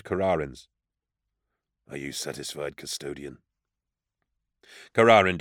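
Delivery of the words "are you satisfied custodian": low, cold and quiet; barely above a murmur; quiet bitterness. A narrator's reading. Bitter challenge to the custodian.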